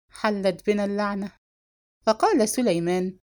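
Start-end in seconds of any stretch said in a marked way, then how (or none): none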